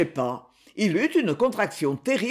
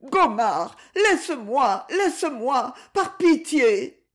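higher, tearful pleading, slowing at the end; desperate begging; 6 semitones higher, slower than usual. Acted. Woman on her knees begs Gomard, tearful pleading. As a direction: beg him for mercy, near tears.

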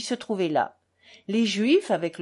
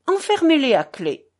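call out; 6 semitones higher, 7 dB louder than usual. A narrator's reading. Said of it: "dit Kalganov".

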